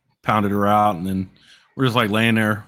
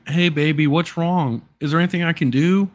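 lightly voiced simpering boyfriend, higher; mock simp; 7 semitones higher than usual. Boyfriend mocking how a simp coddles his girl, higher mock coo. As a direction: coo a needy sweet-talk, half in jest. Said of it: "I could have simped and be like".

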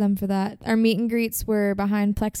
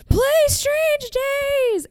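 high, drawn-out heckler's yell; imitated drunken yelling; much slower than usual. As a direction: mimic a drunk fan yelling a song request. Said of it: "and started yelling".

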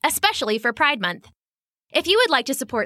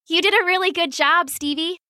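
put-on shy young girl; 7 semitones higher than usual. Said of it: "Sophie said shyly".

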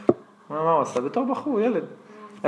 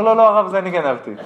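urgent confession; 5 semitones higher, 9 dB louder than usual. Retold or imitated. Thief confesses to the rabbi.

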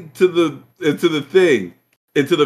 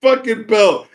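ordinary talk, nothing marked

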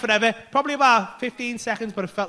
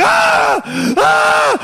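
loud, high held screams; imitated screaming; 13 semitones higher, 6 dB louder than usual. Imitating the friend locked out on shoulders and toes, high screams. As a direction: scream high, twice over. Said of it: "he does stop screaming".

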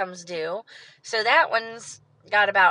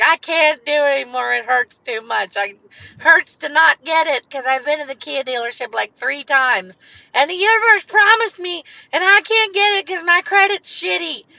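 raised, pleading voice cracking with distress; loud, higher, begging through tears; retold tearful plea; 5 semitones higher, 6 dB louder than usual. To the finance guy on the phone, a loud tearful plea. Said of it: "I was crying because".

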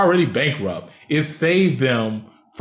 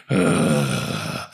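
low, drawn-out growled groan; imitated growl; 12 semitones lower than usual. Host mimicking a film hero's line, low growl. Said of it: "this man growled".

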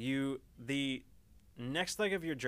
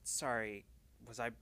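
quietly apologetic; 8 dB quieter than usual. Acted. Preoccupied character apologizes when asked.

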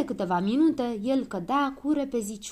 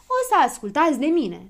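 loud, raised announcement; higher, self-important proclamation; declaring; 6 semitones higher, 7 dB louder than usual. A narrator's reading. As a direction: declare yourself in charge. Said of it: "declară Habar N-am".

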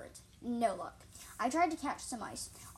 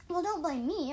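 higher, louder protest; mildly indignant narrated retort; defensive; 7 semitones higher, 7 dB louder than usual. A narrator's reading. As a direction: push back as if wrongly blamed. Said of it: "I hissed defensively".

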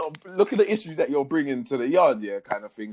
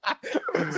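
wheezing laugh; 5 dB quieter than usual. Real, not acted. Laughing fit.

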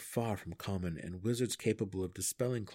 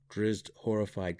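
plain reading voice

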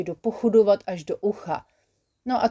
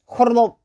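wet cough noise; 3 semitones higher, 7 dB louder than usual. Acted. Sick Hubka announces he wants a snack, loud wet cough. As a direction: hack it out like a sick little creature. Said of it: "Znělo to nějak takhle".

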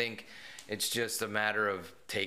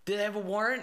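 calling out; 12 semitones higher, 4 dB louder than usual. Retold or imitated. Kid calling to father.

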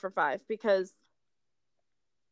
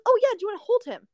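friendly stranger; 10 semitones higher, much faster than usual. Retold or imitated. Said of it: "She goes".

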